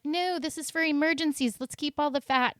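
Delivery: lightly voiced hoarding body; the body talking. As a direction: play your body refusing to let go of fat. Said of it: "Your body's like".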